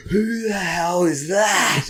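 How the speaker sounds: high, slow amazed question; who is this celebrity; mock amazed crew. Crew staring at Dave, mock amazement.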